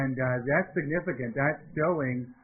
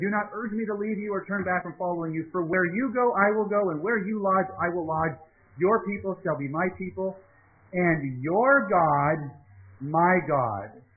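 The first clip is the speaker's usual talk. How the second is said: rising, louder preaching read of the vow; scripture reading; 4 semitones higher, 4 dB louder than usual. Acted. Pastor reading Ruth's vow to the class, building and emphatic. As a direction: proclaim it, slowing hard on your God.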